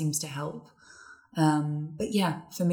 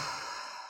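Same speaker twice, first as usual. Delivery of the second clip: long, soft exhale; airy sigh to settle the nerves; demonstrated breath; 13 dB quieter than usual. Shown as a demonstration. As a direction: let out a calming breath before speaking. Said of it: "just take a breath".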